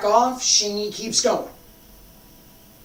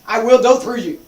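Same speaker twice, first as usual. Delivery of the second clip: louder, driving, unstoppable push; forceful intent; 7 dB louder than usual. Shown as a demonstration. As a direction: say it like you'll walk right through them. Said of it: "Particular today".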